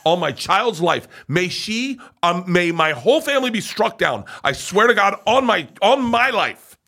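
high, loud, insistent oath; acted-out frenzied insistence; frantic swearing. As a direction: swear it desperately to be believed. Retold frantic oath to his wife.

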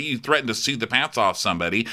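ordinary talk

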